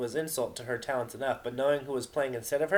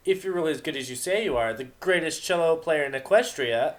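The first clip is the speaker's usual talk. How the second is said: taunting Vinyl; 6 semitones higher, 6 dB louder than usual. Acted.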